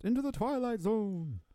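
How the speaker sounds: high, slow, drawn-out spooky voice; hammed-up eerie announcer; mock eerie intro. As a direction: stretch it out high like a spooky show opening. Riffing an eerie show intro.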